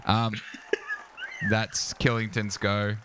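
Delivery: ordinary talk, nothing marked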